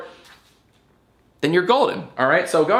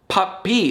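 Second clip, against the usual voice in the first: "Puppy" raised in pitch, ending picked out; emphasized final syllable; 7 semitones higher than usual. Shown as a demonstration. Showing viewers which syllable rhymes, emphasized final syllable. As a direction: say "Puppy" higher, pointing up its last syllable. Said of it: "the final syllable of this one".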